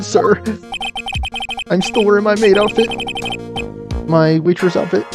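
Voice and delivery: put-on bright high-pitched young woman voice; high girl character voice. Voicing a game character.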